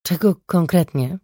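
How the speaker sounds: ordinary delivery, nothing marked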